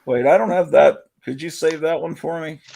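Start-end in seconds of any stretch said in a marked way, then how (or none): 0.0-1.0 muffled, hand over the mouth. Real, not acted. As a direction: talk through a cupped hand. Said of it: "Your mic's going in and out".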